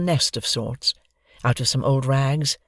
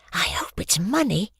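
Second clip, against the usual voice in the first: whispered mutter to herself; breathy hopeful whisper; 6 semitones higher than usual. Acted. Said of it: "she muttered".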